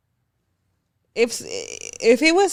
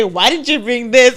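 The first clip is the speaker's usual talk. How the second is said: mock demanding quote; 4 dB louder than usual. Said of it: "I don't say".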